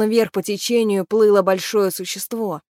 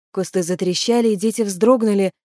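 usual speaking voice

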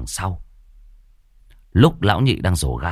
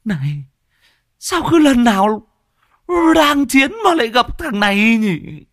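high, loud annoyed squeal; shrill complaint; 5 dB louder than usual. Acted. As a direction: screech at the intruder. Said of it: "choe choé vang lên". (giọng choe chóe bực bội)

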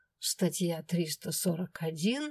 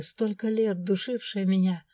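usual speaking voice

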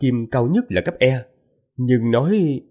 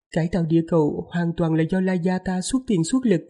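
higher, lightly voiced young wife; man doing a woman's voice; 6 semitones higher than usual. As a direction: speak up high as the gentle wife. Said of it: "Lát giả giọng nữ".

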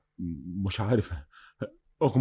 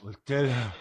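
ordinary talk, nothing marked

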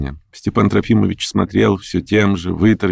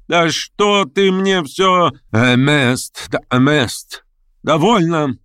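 высокий досадливый возглас; передразни признание и оборви, высоким голосом; раздражённое передразнивание; 9 semitones higher, much slower than usual. A narrator's reading. (раздражённо, на высоких нотах)

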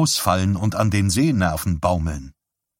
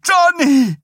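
hysterical triumphant cry; 13 semitones higher than usual. A narrator's reading. Mother thanks God for her Johnny, hysterical triumph. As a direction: start hushed and high, build to a triumphant scream. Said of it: "Ihre Stimme schwoll zu einem hysterischen, triumphierenden Schrei an".

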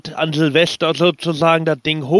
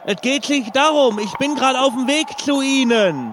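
mock panic; 9 semitones higher than usual. Panicked parachutist prank call.

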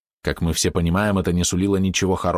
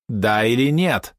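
slow, drawn-out, higher question; insistent demand; 4 semitones higher, much slower than usual. A narrator's reading. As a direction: stretch each word to demand an answer. Impatient repeat of the demand. (медленно, с нажимом, требовательно)

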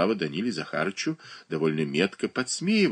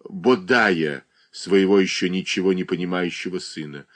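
plain reading voice

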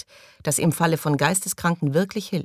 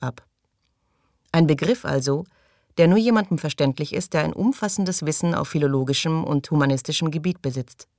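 usual speaking voice

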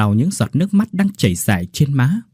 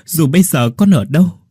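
anguished plea; 5 semitones higher, 3 dB louder than usual. Acted. Father calls dead daughter for vengeance.

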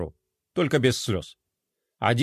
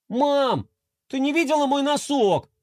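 higher call to mom down the hall; boy calling out; 10 semitones higher than usual. A narrator's reading. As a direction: shout the question from the corridor. (высокий зов мальчика из коридора)

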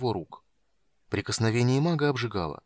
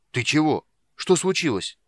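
raised, louder startled questions; alarmed questioning; 6 semitones higher, 5 dB louder than usual. A narrator's reading. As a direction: demand to know what happened. Strongman questions the fleeing girl. (встревоженно, громко)

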